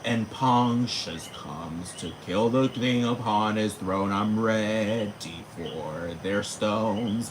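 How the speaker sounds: very slow, solemn mock recital. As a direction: drag out each word like a grand ballad. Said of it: "You know how [name] was like".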